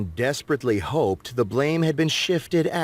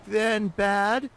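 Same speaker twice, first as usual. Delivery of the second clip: much higher, slow middle-aged woman; Gabby voice; 11 semitones higher, slower than usual. A narrator's reading. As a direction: voice the neighbor woman, high and measured. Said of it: "Gabby said".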